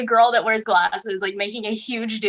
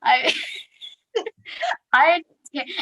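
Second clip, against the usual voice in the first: laughing; 8 semitones higher than usual. Real, not acted. Listener laughing at the story.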